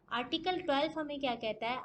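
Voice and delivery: usual speaking voice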